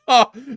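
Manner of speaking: sudden loud, high laugh. Real, not acted. Host cracks up while thanking a donor, loud high laugh. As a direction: burst out in one loud laugh.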